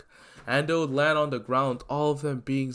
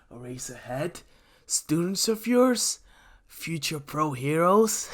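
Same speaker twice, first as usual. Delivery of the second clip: sneering villain; 4 semitones higher than usual. Acted. Villain Shigaraki gloating monologue.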